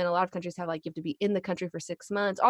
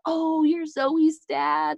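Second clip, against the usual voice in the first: excited recognition; 9 semitones higher, 6 dB louder, much slower than usual. Retold or imitated. Strangers stop Jesse in town.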